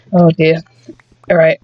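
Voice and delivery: usual speaking voice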